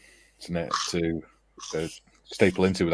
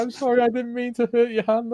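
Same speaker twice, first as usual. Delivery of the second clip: apologetic lad. Retold or imitated. Retelling the lad sorry after the prank, high and pleading. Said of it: "He's like".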